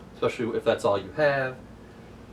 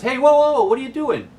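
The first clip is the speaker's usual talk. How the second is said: high, loud startled yell; alarmed shout; 14 semitones higher, 9 dB louder than usual. Retold or imitated. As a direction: stop her mid-bite, loudly alarmed.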